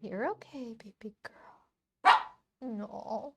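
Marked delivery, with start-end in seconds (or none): 0.0-2.1 hushed whisper to the puppy. Real, not acted. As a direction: whisper so as not to startle her. Whispering to dog.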